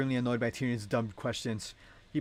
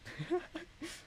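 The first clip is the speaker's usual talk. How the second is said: high laugh; 8 dB quieter than usual. Real, not acted. Laughs after the line.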